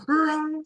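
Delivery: very high startled roar back; hammed-up instinctive roar at a jump scare; mock fighter roar.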